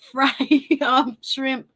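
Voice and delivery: ordinary talk, nothing marked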